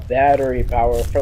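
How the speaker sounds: normal talking voice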